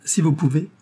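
mocking little laugh, lightly voiced. A narrator's reading. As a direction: toss it off with a short laugh. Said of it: "avec un petit éclat de rire".

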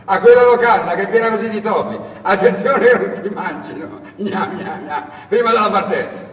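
high, loud giggly mocking warning; chuckling taunt. Ciccione chuckling after departing Asad, loud giggly taunt. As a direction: snicker as he leaves. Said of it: "voce ridacchiante del Ciccione".

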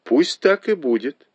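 high-pitched, pleased assent; exclaiming agreement. A narrator's reading. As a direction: agree brightly, pitch lifted. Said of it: "воскликнула Фэнзя".

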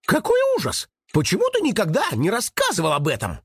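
very high rabbit outcry; horrified exclamation. A narrator's reading. Rabbit shocked by the raven's story, exclaiming. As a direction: exclaim high, then rush the question. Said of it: "Крош воскликнул".

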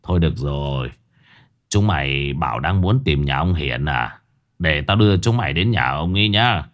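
a sigh before giving in; sighing old man. A narrator's reading. Old man agrees to lead reporters to Hiển. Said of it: "Người đàn ông thở dài".

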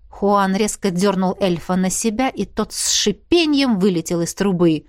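plain reading voice

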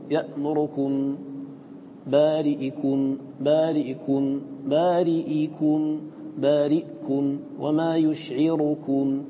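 بارئكم and يشعركم repeated, vowel snatched short; repeated slow demo. Shown as a demonstration. Teacher drilling the snatched vowel for students. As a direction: repeat each word slowly, snatching the vowel. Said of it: "بالاختلاس".

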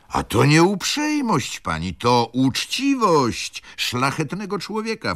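steward interjecting. A narrator's reading. Steward defending Wokulski to the ladies, lightly voiced. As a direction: cut in warmly as the honest steward.

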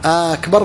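أكبر said as "aakbar", first vowel long; lengthened hamza of أكبر. Shown as a demonstration. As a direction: open أكبر on a drawn-out "aa". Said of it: "يعني مد همزة".

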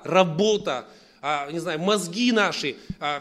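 normal talking voice

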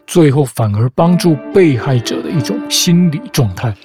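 usual speaking voice

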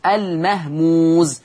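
المهموز said very slowly, each syllable stretched; slow emphatic المهموز; drawn-out word. Shown as a demonstration. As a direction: drag out المهموز to stress it. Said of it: "اللين الإيه؟".

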